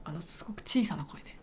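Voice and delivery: hushed, breathy whisper. Real, not acted. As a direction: keep it down in the silent waiting room. (小声でささやく)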